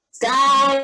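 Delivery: usual speaking voice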